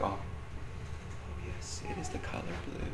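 whispery, very soft mock-gentle answer; faked gentleness. Dad faking patience while making eggs, whispered mock gentleness. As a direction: breathe it out softly, overdoing the gentleness. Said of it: "I could have faked it".

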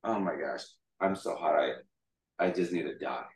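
lightly acted exhausted groaning words; fainting. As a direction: act faint from the heat. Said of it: "And he was fainting".

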